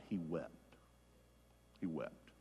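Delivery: hushed, low and grave; somber. Real, not acted. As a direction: let it sink softly, leaving space. Somber end of Peter's denial story.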